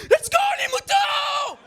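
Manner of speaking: high, slow shouted call to the crowd; rally the room like a herder calling sheep. Acted. Comedian shouting to the audience like calling sheep, high slow shout.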